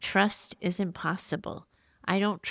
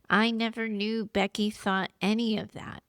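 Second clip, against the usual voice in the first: ordinary talk, nothing marked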